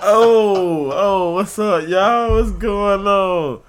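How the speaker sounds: loud, high hype hello; big hype shout-out; excited greeting. Real, not acted.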